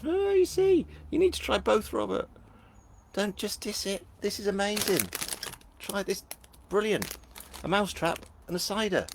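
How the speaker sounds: high-pitched comic pleading; mock-indignant urging. Host urges a doubting viewer to try both, high mock indignation. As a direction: plead in a squeaky, hammed-up voice. Mock-urgent defence of cheese and fruitcake.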